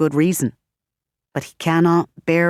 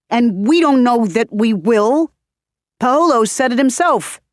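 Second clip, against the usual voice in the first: insistent; 6 semitones higher, 5 dB louder than usual. Acted. Manny argues heatedly.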